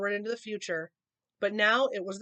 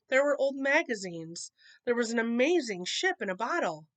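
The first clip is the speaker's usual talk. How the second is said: usual speaking voice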